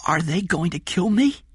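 put-on high, halting child's voice; frightened child. Jeff fears being killed, frightened child. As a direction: stammer the question, high and small.

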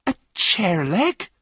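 high, drawn-out astonished question; surprised. A narrator's reading. Runaway hearing of the weapon, surprised. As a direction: echo it slowly in high surprise. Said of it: "replied John in surprise".

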